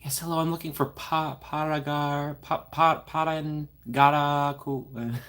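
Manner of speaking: stumbling over "Parangaraku", restarting, then sounding it out very slowly; asking a stranger for directions, mangling the name; struggling pronunciation. Pretend. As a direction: fumble the name, then spell it out syllable by syllable. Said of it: "Always ask others to pronounce it".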